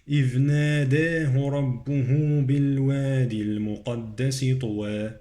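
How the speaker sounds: sustained tajweed recitation with long vowels; Quran recitation. Shown as a demonstration. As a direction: recite the verse steadily, drawing out vowels. Recites the verse.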